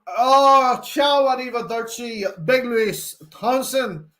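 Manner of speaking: loud, drawn-out mock Italian accent; put-on Italian accent. Streamer greeting a viewer, put-on Italian accent. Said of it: "why I put an accent on it".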